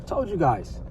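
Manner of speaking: ordinary delivery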